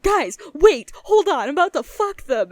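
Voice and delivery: high, loud, fast hype call to the guys; excited gamer call. Retold or imitated. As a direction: blurt it out to the whole group, rushing. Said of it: "And then you're like".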